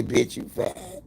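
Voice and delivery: breathy unvoiced whisper to herself; muttering insults at her reflection; whispered self-talk. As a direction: hiss it at the mirror in a whisper. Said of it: "when I look at myself and I say".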